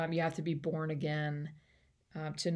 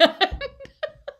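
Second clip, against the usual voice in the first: a bright high burst of laughter; delighted high laugh; 5 dB louder than usual. Real, not acted. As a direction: laugh out loud at the thought. Laughs after acted Jesus line.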